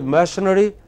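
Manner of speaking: machinery as MASH-uh-nuh-ree; mistaken pronunciation. Shown as a demonstration. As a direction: put the stress on MASH in machinery. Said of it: "If you pronounce it as".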